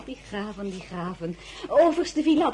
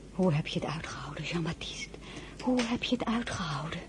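whispering; 5 semitones lower, 7 dB quieter than usual. Acted. Tender whisper to absent husband.

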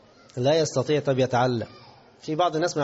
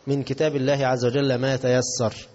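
ordinary talk, nothing marked

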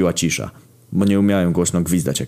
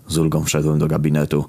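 ordinary delivery

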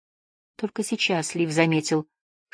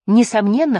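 ordinary delivery, nothing marked

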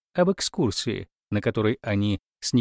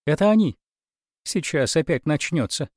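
alarmed exclamation; 5 semitones higher, 3 dB louder than usual. A narrator's reading. Character dreads arriving relatives.

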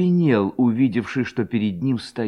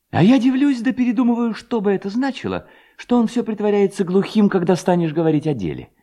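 higher-pitched narrated musing; indignant puzzlement; 10 semitones higher than usual. A narrator's reading. Levko muses indignantly about his father. (удивлённо, повышенным тоном)

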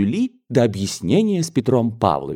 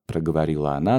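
usual speaking voice

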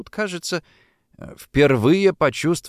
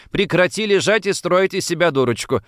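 higher-pitched, impatient telling-off; impatient shout; 7 semitones higher than usual. A narrator's reading. Sister ordering the girl to get up, impatient. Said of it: "нетерпеливо прикрикнула Сара".

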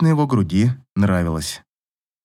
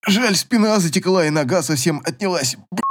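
strained, higher-pitched complaint; pained; 5 semitones higher than usual. A narrator's reading. Averin complains about his stiff back, pained. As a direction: grumble through a cramp, cutting off the swear. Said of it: "Лицо его страдальчески скривилось".

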